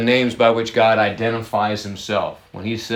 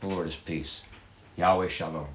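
soft solemn intoning; 7 semitones lower, 12 dB quieter than usual. Shown as a demonstration. Pastor softly intoning the name to his congregation. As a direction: lower and softer, "peace" nearly hushed.

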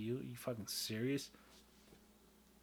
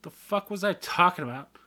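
loud, higher, frustrated; exasperated outburst; 7 semitones higher, 11 dB louder than usual. Real, not acted. Vlogger loudly frustrated at forgetting his point. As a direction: blurt it out in frustration.

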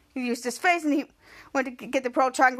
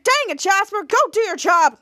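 louder, higher mock telling-off; mock scolding; 5 semitones higher, 5 dB louder than usual. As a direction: act out an exasperated order. Acted order to Jasper.

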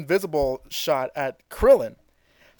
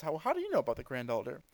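quiet question; 12 dB quieter, faster than usual. Retold or imitated. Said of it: "looks up to Piccolo and says".

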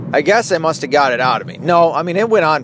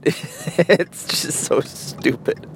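wheezing through laughter; laughing; 4 dB quieter, much slower than usual. Real, not acted. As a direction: laugh it out slowly. Laughing at own story.

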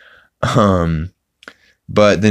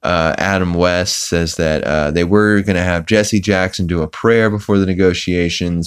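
ordinary talk

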